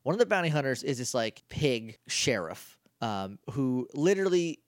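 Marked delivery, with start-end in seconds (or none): none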